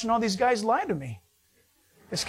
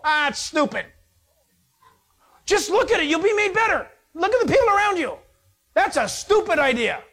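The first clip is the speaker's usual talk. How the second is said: high, louder mocking whine; scoffing mockery; 10 semitones higher, 5 dB louder than usual. A doubting Israelite mocking Moses' command, high scoffing whine. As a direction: sneer at the silly idea.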